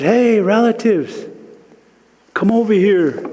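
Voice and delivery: high, slow call to the clouds. Retold or imitated. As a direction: call out across the sky to distant clouds. Calling out to the clouds.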